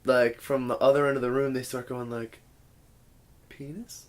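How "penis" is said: very soft, rising question; tentative quiet call. Retold or imitated. Girl calls from across the bedroom at night, very quiet. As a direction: float it out barely audibly, pitch lifting. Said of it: "it starts getting louder".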